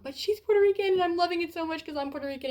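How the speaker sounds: high-pitched, delighted rush; happy excitement. Real, not acted. Reader thrilled the character shares her Puerto Rican roots, happy excitement. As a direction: gush with joy, voice lifted high. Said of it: "it makes me happy".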